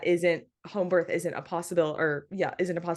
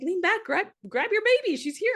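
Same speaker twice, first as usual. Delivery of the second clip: imitating excited midwives; 12 semitones higher than usual. Midwives telling her to grab baby.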